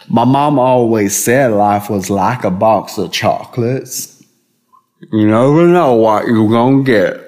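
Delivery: slow, drawn-out Southern movie-quote drawl; slow Southern accent impression; Forrest Gump. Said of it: "We got a movie quote".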